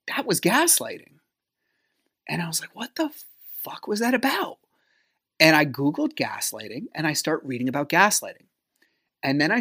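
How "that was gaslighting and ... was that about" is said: high, hushed disbelief; hushed realization. Retold or imitated. On the couch later that night, hushed incredulous realization. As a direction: let it dawn on you in a high, stunned half-whisper.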